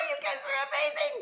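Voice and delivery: high, fast gush of delight; excited praise. Real, not acted.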